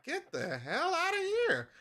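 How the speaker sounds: high, loud, retold cry of outrage; very upset. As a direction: act out throwing the singer off the radio. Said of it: "'cause I was very upset".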